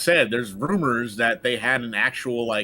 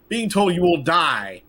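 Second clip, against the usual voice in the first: normal talking voice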